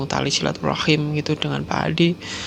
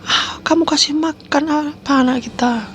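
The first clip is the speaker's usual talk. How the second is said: horrified yell; 10 semitones higher, slower than usual. Retold or imitated. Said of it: "gitu, Pak Adi teriak-teriak".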